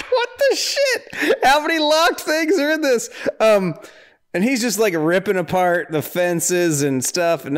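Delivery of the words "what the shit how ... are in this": high squeal of disbelieving laughter; laughing. Real, not acted. Viewer laughing at the movie's endless locked doors.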